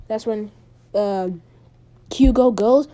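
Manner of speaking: ordinary delivery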